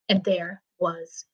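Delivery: slow whisper into silence; slow, soft, hushed close. A narrator's reading. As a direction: let the silence settle, barely breathed. Suspense after the fall.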